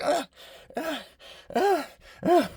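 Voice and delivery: high squealing ah ah ah of stinging eyes; imitating a girl's high yelps of pain; mock pained high cries. Girl stung by sweat in eyes.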